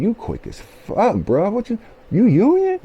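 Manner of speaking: high, amazed coworker voice; coworker impression. Union coworker marvelling at his speed, high amazed voice. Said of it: "this one cat come up to me like".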